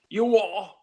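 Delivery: loud, high mock London accent. Shown as a demonstration. Asked for a British accent, loud mock London. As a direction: snap it out like a London gangster. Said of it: "maybe a little London".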